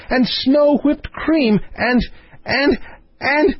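high, delighted princess voice; excited princess. Acted. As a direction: get carried away with glee, pitched high. Princess character in story.

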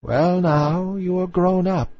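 put-on a proud old dowager taking her time; old grandmother. A narrator's reading. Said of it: "said the old dowager, her grandmother".

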